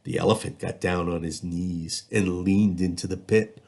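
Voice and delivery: ordinary delivery, nothing marked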